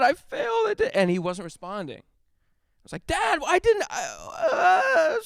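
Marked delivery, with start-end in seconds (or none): 0.0-0.9 put-on high, sobbing child's wail; crying kid. Seven-year-old sobbing to his passed-out dad, high crying wail. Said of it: "I looked at my dad". 3.1-5.2 high, broken, mock-crying child voice; imitating a sobbing kid. Boy pleading with his unresponsive dad, high mock sobbing. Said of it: "so crying".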